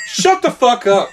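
mimicking mom telling him to shut up; mom snapping. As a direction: bark it like a furious mother woken at two. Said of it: "she was like".

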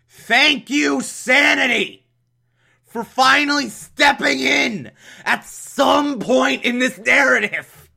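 high, loud sarcastic cry of relief; exasperated shout. Real, not acted. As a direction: yell it up at the ceiling, fed up. Sarcastic outburst at the plot.

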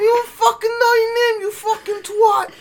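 screeching, high-pitched fake fury; mock rage. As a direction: shriek insults way up high, hamming up the anger. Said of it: "All right, [name]. Settle down".

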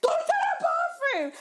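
very high, loud, drawn-out yell; hammed-up panicked order, laugh behind it; imitated shouting. Boyfriend shouting at vomiting girlfriend, imitated frantic shout. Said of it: "He's shouting at me".

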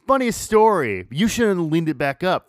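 high, loud opener that eases down; retold confession to manager. Worker explaining broken door to manager, high and emphatic. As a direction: launch brightly into an awkward explanation.

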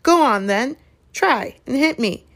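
worked-up challenge; daring challenge. Acted. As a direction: egg them on to a fight. Neville daring them to fight.

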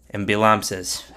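usual speaking voice

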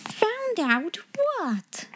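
very high, drawn-out question; shocked exclamation. A narrator's reading. Headmistress demanding what is hidden, high slow shock. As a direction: stretch each word in disbelief. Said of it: "she exclaimed".

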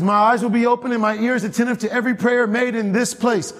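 high ringing pulpit reading; preached scripture. Acted. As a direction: proclaim God's words from the pulpit. God's words proclaimed.

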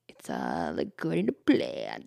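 very low, slow villain voice; scheming inner voice. Acted. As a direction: drop deep and draw it out like a secret schemer. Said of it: "in the back of my brain, silently".

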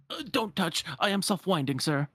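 high, affronted clock voice; Cogsworth. Acted. Clock refusing to be handled, high and indignant. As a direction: fend off prying hands fussily. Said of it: "Cogsworth, who's like".

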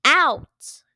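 loud, curt order; shouted command. Acted. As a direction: bark him off stage. Judge throws contestant out.

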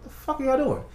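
high, incredulous question; confused fighter asking the judges, high and baffled; baffled outrage. Retold or imitated. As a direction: ask like nothing makes sense. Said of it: "I'm like".

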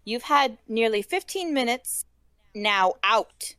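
firmly. A narrator's reading. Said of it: "she said firmly".

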